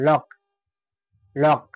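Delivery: "লক" with one k sound, C silent. Shown as a demonstration. Teacher showing C before K, "লক" with C unspoken. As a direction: say "লক" ending on a single k. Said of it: "এই সির উচ্চারণটা কিন্তু হচ্ছে না".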